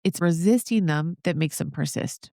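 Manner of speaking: normal talking voice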